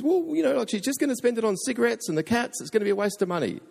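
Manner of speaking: high, fast protesting imitation; mock objection. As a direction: put on a high-pitched complaining voice. Voices a reluctant objector.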